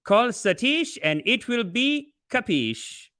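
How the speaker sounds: drawn-out, high-pitched realtor slogan; real estate agent. Acted. Host mimics real estate agents, salesman's slogan voice. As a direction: pitch it slowly like a realtor's catchphrase. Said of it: "like the real estate agents".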